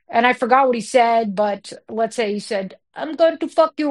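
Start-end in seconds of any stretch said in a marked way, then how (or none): 2.9-3.9 higher-pitched mock Nigerian accent. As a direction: imitate a Nigerian man's accent. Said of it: "And I did the accent".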